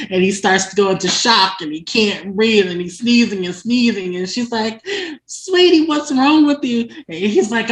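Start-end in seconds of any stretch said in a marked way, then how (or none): 5.3-6.9 high, loud mock-sweet fussing; concerned admirer. Tabitha asking sneezing Clay what's wrong, concerned. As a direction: fuss over him in a raised voice.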